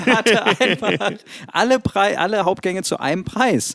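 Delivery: speaking through a laugh, amused. Real, not acted. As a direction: crack up while telling it. Laughing about Kalli's menu.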